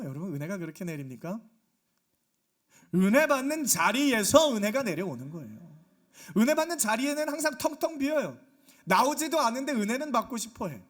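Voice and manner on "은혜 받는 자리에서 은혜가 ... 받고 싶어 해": loud, high-pitched sermon exhortation; full-force preaching. Real, not acted. Preacher rebuking those absent from worship, full-force preaching. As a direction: drive the point home to the congregation.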